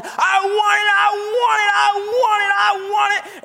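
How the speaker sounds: put-on high, crying toddler shriek of I want it; screaming toddler. Mimicking a toddler screaming at his mom in the store. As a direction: wail like a kid in a full grocery store fit. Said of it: "he was just screaming".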